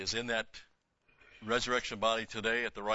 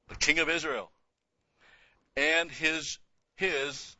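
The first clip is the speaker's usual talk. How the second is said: raised, ringing scripture reading, louder; proclaiming scripture; 7 semitones higher, 6 dB louder, slower than usual. Acted. Bible teacher to class, raised reading voice. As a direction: proclaim the verse to the congregation.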